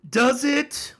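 high, loud rising question; loud challenge. Real, not acted. Host challenging the claim the Bible says so, loud and incredulous. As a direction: fire it back, pause, fire it again.

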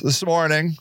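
usual speaking voice

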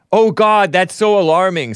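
high, loud, hammed-up gasp of alarm; mocking fake concern. Host mocking a Twitter reply with high, loud fake alarm. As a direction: ham up the fake horror.

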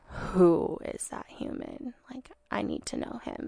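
slow, breathless whisper. Retold or imitated. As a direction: whisper in awe. Retold thought at first sight.

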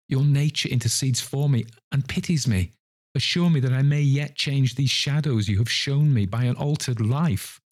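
high, earnest entreaty; beseeching, impassioned old sinner; pleading penitent. Acted. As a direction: beg the spirit for mercy, pitch held high.

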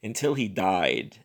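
ordinary delivery, nothing marked

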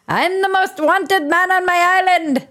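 high, hammed-up attempt at an Irish accent; bad Irish accent. Host mangling an Irish accent for a joke, high and hammy. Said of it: "I cannot do an Irish accent".

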